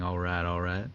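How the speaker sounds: light Texan McConaughey twang on the catchphrase; an easy Texan McConaughey catchphrase; McConaughey drawl. Retold or imitated. Podcast host riffing as McConaughey, light Texan twang. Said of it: "Do a little McConaughey".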